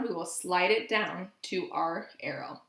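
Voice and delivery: ordinary talk, nothing marked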